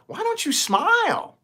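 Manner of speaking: high mocking stranger's voice; stranger's condescending question, mimicked high. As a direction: squeak it like a nosy stranger. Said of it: "random strangers walk up to me and say".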